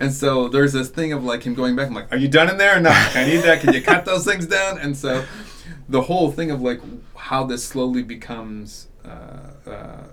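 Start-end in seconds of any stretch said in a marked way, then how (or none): 2.1-4.8 raised, higher nagging voice; lightly acted pestering lawman; impatient sheriff. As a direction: badger them, louder and higher. Acting out sheriff nagging again.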